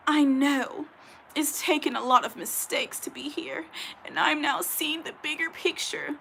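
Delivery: hammed-up tearful apology; mock tearful abuser. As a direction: sneer at her fake remorse by whispering it. Quoting abuser's tearful statement.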